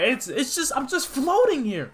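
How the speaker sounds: high-pitched flustered protest; acted boy losing patience; getting mad. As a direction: stammer an indignant objection way up high. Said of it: "Starting to get mad".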